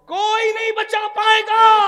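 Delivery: کوئی نہیں بچا پائے گا shouted high and loud; preacher's shouted threat; shouted warning. Shown as a demonstration. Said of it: "repeated shouted".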